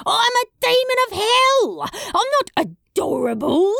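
high-pitched, outraged squawk; indignant protest. A narrator's reading. Crowley insisting he is a demon of hell, outraged. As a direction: protest with wounded demonic pride.

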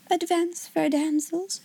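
high, gentle, regal make-believe; happy dream voice. A narrator's reading. Sarah as princess welcoming her guests, happy dream voice. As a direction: proclaim it lightly, as in a happy dream. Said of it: "in her happy dream voice".

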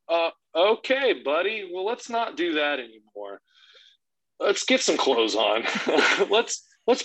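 high-pitched, hesitant dad voice; gently steer a small child, uneasy; awkward parental reassurance. Retold or imitated. Dad redirecting his naked son, awkward high-pitched tact.

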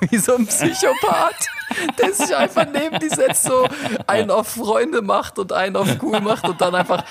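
yelling it out through laughter; laughing shout. Real, not acted. Lachend geschrien.

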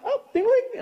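very high "ding, ding"; phone chime imitation. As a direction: chirp like a phone alert.

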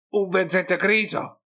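obiezione acuta e più forte; objecting. A narrator's reading. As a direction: batti il pugno e ribatti incredulo. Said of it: "obiettò Isaac Grotle, picchiando un pugno". (obiezione brusca, più acuta)

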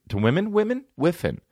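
"whiffin'" with F for M; slip of the tongue. Shown as a demonstration. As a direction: say "whiffin'" instead of women. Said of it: "you change M's to F's when you talk too fast".

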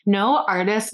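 ordinary talk, nothing marked

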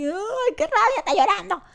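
high, whiny imitation of someone crying angrily; mocking the angry crier, high and whiny; mock crying rage. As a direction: squeak it out like a sulky crybaby.

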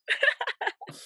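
high-pitched burst of laughter; real laugh. Real, not acted. Mum looking back on a sleepless night, laughing. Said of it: "I'm laughing now".

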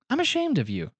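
high, soft, hurt whimper; wounded whimper. Pretend. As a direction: sound let down and wounded. Repeat in hurt voice.

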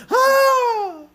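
high excited fan yell; cheering shout. Retold or imitated. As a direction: yell like your player just scored. Fan cheering a basketball shot.

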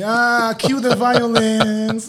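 high drawn-out comic wail; mock dramatic voice. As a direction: ham up the cat drama. Joking about cat's violence.

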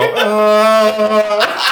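high, hammed-up revving groan; tractor cow rev. Shown as a demonstration. Telling a friend, imitating a tractor cow revving. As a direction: rev like the tractor cows from Cars. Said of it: "they rev their engine and they go".

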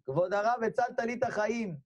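high, heartfelt exclamation; emotional gratitude. Retold or imitated. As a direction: cry out thanks to your rescuer. Saved man thanks the rabbi. (הודיה נרגשת)

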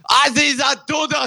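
put-on high, loud German accent shout; movie villain German accent. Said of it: "making the".

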